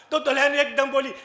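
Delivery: ordinary delivery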